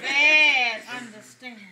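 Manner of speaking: slow, loud "Bad understanding"; punchline. Real, not acted. Preacher landing the joke to the crowd, emphatic. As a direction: lean hard on "bad" as a catchphrase. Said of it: "Some people have bad understanding".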